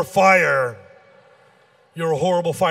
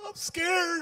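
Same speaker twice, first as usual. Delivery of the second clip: high, soft, tearful whimper; mock crying kid voice; scared child; 10 semitones higher, 7 dB quieter than usual. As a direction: whimper like a frightened little boy. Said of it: "He gets nervous".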